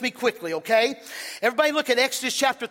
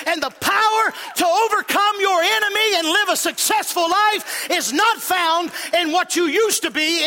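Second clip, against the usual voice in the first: higher, louder preaching shout building to a peak; full-force preaching; 6 semitones higher, 3 dB louder than usual. Acted. Pastor rousing the congregation, full-force preaching shout. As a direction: preach it out to the whole church at full power.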